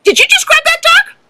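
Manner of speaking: mock outraged judge; indignant judge. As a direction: squeal it out like a shocked official. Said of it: "the judge goes".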